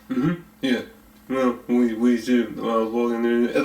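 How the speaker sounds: light imitation of an indistinct mumbler; mock low-key mumbling, words running together; low-voiced mumbler. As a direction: mumble it like someone who won't speak up. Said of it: "he speaks in such a low voice".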